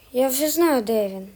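normal talking voice

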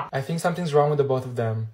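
ordinary delivery

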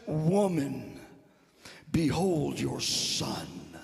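Jesus on the cross to Mary, low and slow. Acted. Preacher voices Jesus addressing his mother, low and solemn. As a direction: speak low and drawn out as Jesus. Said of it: "He says".

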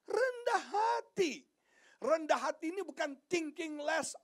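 high, loud, drawn-out preaching; preaching at full force. Acted. (khotbah berapi-api, suara tinggi dan lantang)

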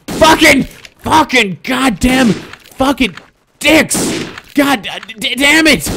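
high-pitched, exasperated swearing; frustrated cursing. Real, not acted. Player cursing at the game, frustrated. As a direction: curse in a strained, high voice.

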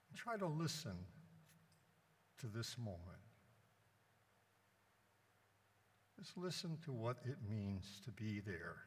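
measured meditation with long silences; guided meditation. Acted. Priest guiding the congregation to be with Jesus, meditative. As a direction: invite them to rest in the moment, leaving space between phrases.

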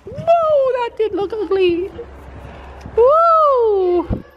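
high, exclaimed "whoa" reaction; startled whoa. Real, not acted. Rider's friend reacting to an ugly jump, startled.

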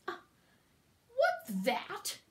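high, indignant question; haughty, startled demand; snorting. A narrator's reading. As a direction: snort it in affronted surprise. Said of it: "snorted Yertle".

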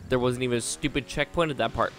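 usual speaking voice

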